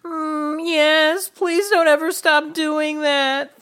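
very high, slow, blissful moan; moaning high Thea voice. Acted. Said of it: "Thea moaned".

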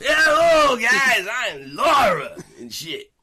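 high, loud cartoon girl shout; loud squeaky Laura voice. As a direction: squeal it out like a brat. Laura voiced loudly, laughing.

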